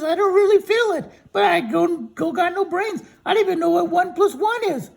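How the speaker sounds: high, loud cartoon scarecrow; storyteller voicing a high silly scarecrow. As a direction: pitch way up and call out as the scarecrow. Scarecrow talks to Dorothy.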